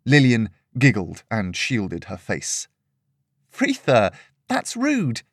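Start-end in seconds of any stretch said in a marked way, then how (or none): 3.5-5.2 playful high-voiced scolding; light chiding. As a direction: tell the dog off gently, half laughing. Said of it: "lightly chastised".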